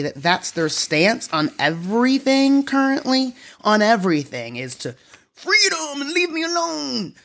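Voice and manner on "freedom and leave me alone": hammed-up cry for liberty; mock protest cry. Host mimicking Republicans' stance on everything, mock protest.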